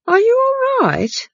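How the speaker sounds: high, slow, fussy housekeeper; housekeeper's voice. Acted. Housekeeper checking on dazed Granny, high and slow. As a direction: lift right up into the anxious housekeeper.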